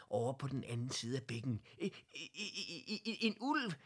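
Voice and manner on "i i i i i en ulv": high, stuttering cry of fright; frightened stammer. A narrator's reading. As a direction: stammer then blurt out the wolf. Wolf spotted, stammering fright.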